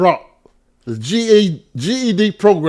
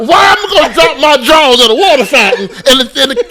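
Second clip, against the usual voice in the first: incredulous; 9 semitones higher, 8 dB louder than usual. Real, not acted. Incredulous laughing retort.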